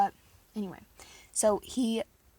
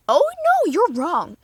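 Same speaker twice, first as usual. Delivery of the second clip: exclamation; 8 semitones higher, 9 dB louder, slower than usual. Real, not acted. Correcting imagined listener.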